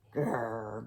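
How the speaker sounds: deep grumpy bear growl; low growl. Acted. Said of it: "growled Bear".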